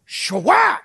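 loud smack sound effect; hammed-up whack noise; mock spoon smack. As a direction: slap the word out like a spoon hit. Mom threatening kids with spoon.

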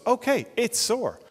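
put-on high, slow little-boy voice; small child on the edge of wailing; child accepting the hurt. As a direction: pitch it up, drawn out and childlike. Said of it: "And so he goes".

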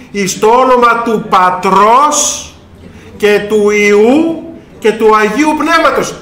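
slow, weighty liturgical recitation; solemn intoning. Retold or imitated. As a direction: intone it like at a baptism. Quoting the baptismal formula.

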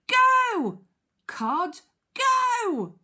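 high, loud, drawn-out cry; urging shout. Acted. Teacher reading Duck urging cod to flee, urging shout.

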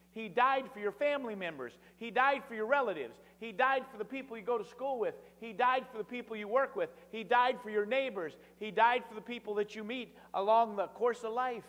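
full-force preaching. Acted. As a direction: build the list like a pulpit crescendo.

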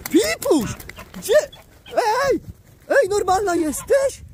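high call. Real, not acted. Calling the pet duck.

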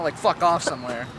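ordinary talk

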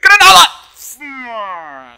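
long, high, full-force yell; alarmed gamer yell; drawn-out shout. Real, not acted. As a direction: bellow it out, stretching the word. Yells at incoming grenade in game.

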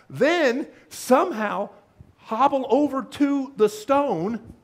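rising, slow, emphatic preaching; building preaching. Acted. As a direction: build it step by step, hammering each phrase. Sermon build-up on resurrection.

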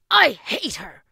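put-on loud bitter boy; angry outburst. A narrator's reading. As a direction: spit it out furious. Boy's tantrum.

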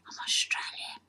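a soft, breathy stage whisper; mock whisper. Fake applicant confides in interviewer, hushed mock whisper. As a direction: confide it like a guilty secret.

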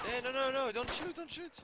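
high, loud panicked plea; panicked surrender shout. Real, not acted. Panicked plea not to shoot.

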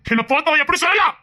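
indignant outburst; outraged. Acted. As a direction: protest in wounded outrage. Outraged rejected boy.

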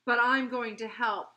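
loud, emphatic angry declaration; furious. A narrator's reading. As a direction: fling it out grudgingly and furiously. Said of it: "she said furiously".

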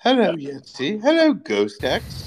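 high spooky ghost voice. Pretend. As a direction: call out like a haunting ghost. Doing the ghost voice.

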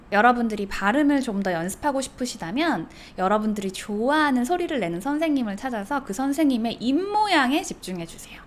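usual speaking voice

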